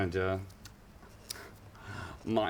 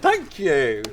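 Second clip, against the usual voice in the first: mock gracious thanks; 7 semitones higher, 8 dB louder than usual. Taking a bow after segue.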